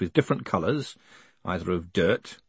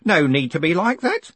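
put-on higher, pressing old-man objection; upset protest; 8 semitones higher, 3 dB louder than usual. Grote objects to his boss's sarcasm, upset and high. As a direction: raise the pitch in reproach.

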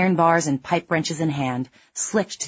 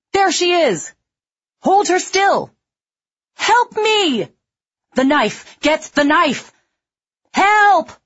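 high, loud frenzied cries; wild mob screaming much higher; frantic shouting; 7 dB louder, slower than usual. A narrator's reading. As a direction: yell over each other in a frenzy. Crazed survivors babbling over a victim.

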